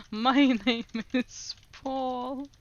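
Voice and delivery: My name is Paul said very slowly, word by word; slow mock-solemn translation; slow deliberate reading. Shown as a demonstration. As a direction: spell it out slowly and loudly. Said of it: "translating the app slowly".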